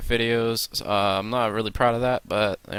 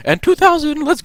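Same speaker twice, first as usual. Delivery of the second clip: excited; 6 dB louder than usual. Real, not acted. Building subscriber goal hype.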